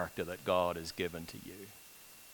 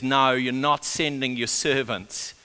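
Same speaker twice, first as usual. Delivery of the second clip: raised, louder, insistent plea; firm, urgent pleading, higher and louder; pleading woman; 4 semitones higher, 7 dB louder than usual. Retold or imitated. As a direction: act out a mother refusing to be turned away. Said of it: "She pleads with him".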